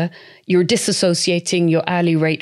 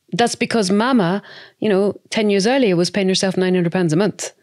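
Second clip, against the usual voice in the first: normal talking voice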